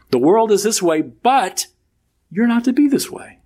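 high, ringing stress on but and not; emphatic contrast. Acted. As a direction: punch the contrast between world and you. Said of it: "are emphatic".

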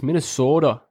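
"Minnesota" with exaggerated Minnesotan long O; Minnesotan accent. Shown as a demonstration. Showing the only Minnesotan sound he knows, round O in "Minnesota". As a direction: lean on the O in "Minnesota", Minnesotan style. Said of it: "Yeah, the O's. The only thing I know".

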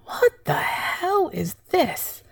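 high, startled disbelief; high, baffled what-the-hell; bewildered outburst. Retold or imitated. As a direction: pitch it up in shocked confusion. Sudden confusion at own tears.

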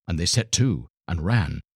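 ordinary delivery

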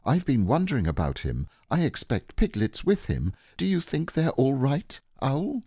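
put-on high boy's voice cutting in; anxious Christopher Robin. Boy interrupting Owl about Pooh, high and anxious. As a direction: break in with a high, worried question.

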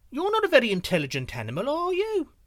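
loud, high mocking bluff; scornful deer. A narrator's reading. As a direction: sneer at the tiger to fool it. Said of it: "he replied scornfully".

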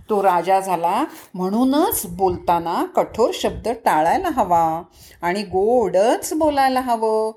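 plain reading voice

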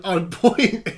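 ordinary talk, nothing marked

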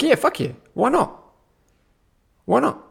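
high, eager why-not; excited yes. Retold or imitated. Hyped about helping the cyclist, high and excited. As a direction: pitch it way up, thrilled. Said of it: "I'm like".

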